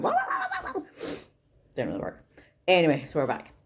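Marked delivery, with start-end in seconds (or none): 0.0-0.8 high yappy barking noises, laughing; imitating dog bark. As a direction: yap like a small excited dog. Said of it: "before the dog went".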